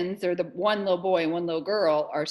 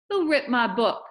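loud, higher accusing question; indignant demand; 6 semitones higher, 8 dB louder than usual. Acted. Amanda confronts the boys over her torn book, indignant demand. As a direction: demand to know the culprit.

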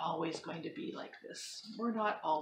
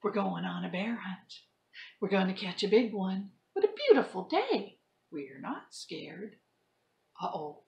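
lively storybook read-aloud; reading to children; 5 semitones higher, 12 dB louder than usual. Acted. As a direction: act the story out for kids. Teacher reads picture book to class.

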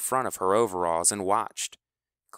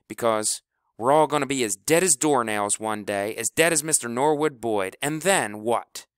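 louder, rising fervor; passionate; 4 semitones higher, 5 dB louder than usual. Acted. House lectures the team about death, louder and fervent. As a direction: preach it to them.

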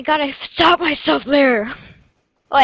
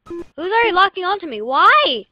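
annoyed yelling; 8 semitones higher, 5 dB louder than usual. Real, not acted. Annoyed at enemy lock-on.

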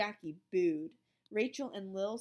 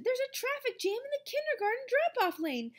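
higher, louder frantic plea; urgent; 6 dB louder than usual. A narrator's reading. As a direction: hurry it out in a panic. Panicked teacher.

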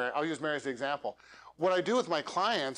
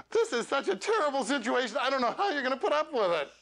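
higher, bright sing-song pity; cheery fake sympathy; 8 semitones higher than usual. Shown as a demonstration. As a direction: sound chirpy while saying something sad. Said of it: "Okay, you just lost trust".

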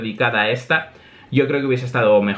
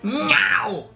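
high feline yowl; cat meow; 9 semitones higher than usual. Acted. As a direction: imitate an attacking cat. Cat attacks.

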